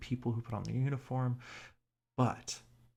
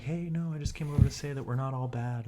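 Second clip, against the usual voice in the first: higher, mock-friendly officer voice; mock sweet cop; 5 semitones higher, 3 dB louder than usual. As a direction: play the cop as sugary and reassuring. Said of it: "this cop is just like".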